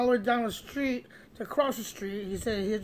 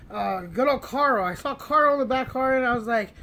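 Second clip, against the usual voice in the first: plain reading voice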